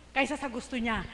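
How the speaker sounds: usual speaking voice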